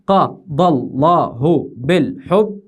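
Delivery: slow, evenly beaten separate syllables; syllable-by-syllable scansion. Shown as a demonstration. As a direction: beat out the verse syllable by syllable. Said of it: "يلا يا أخوي نقطع". (تقطيع عروضي بطيء مقطعًا مقطعًا)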